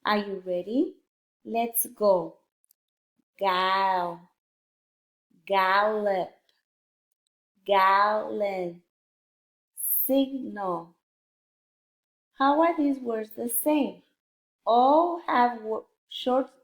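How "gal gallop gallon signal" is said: careful, drawn-out reading of a word list; slow word reading. Shown as a demonstration. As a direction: read each word slowly, syllable by syllable. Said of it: "a little bit faster".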